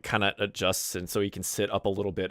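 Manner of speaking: normal talking voice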